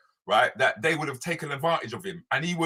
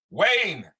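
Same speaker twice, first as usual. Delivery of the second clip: calling out; 6 semitones higher, 5 dB louder than usual. Real, not acted. Calling out to a caller.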